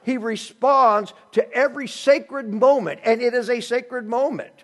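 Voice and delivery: loud, high pulpit preaching; full-voiced sermon delivery; fervent preaching. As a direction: preach it out to the congregation.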